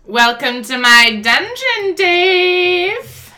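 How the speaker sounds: slow, drawn-out villain welcome; lightly menacing villain greeting; slow theatrical villain menace. Acted. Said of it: "do my evil villain speech".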